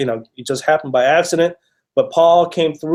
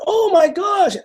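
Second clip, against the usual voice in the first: much higher, delighted squeal of recognition; excited gasp; slower than usual. Retold or imitated. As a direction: squeal it high like spotting an old friend. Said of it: "and she's like".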